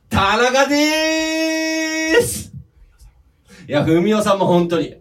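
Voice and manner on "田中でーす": high, very drawn-out stage shout. Retold or imitated. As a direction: imitate a musician hollering his name. Imitating musician's self-introduction.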